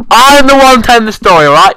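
high, loud indignant snap; mock-angry outburst. Kid telling off friend who spoiled his story, loud mock anger. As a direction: put the interrupter in his place.

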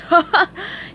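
hearty gruff crab chuckle; a boss's greedy guffaw; belly laugh. A narrator's reading. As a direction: laugh from the gut. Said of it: "with a belly laugh".

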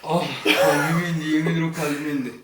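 shaken laugh. Real, not acted. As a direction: laugh off the fright, still shaken. Shaken, laughing after the scare.

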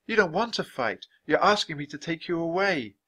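put-on high-pitched girl's voice; small girl, sympathetic and high; caring young girl. A narrator's reading. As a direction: talk high and earnestly to an animal.

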